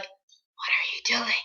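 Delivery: imitating a slow whisper from behind; whispered question. Woman behind asking at an audition, whispered. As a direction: whisper it covertly.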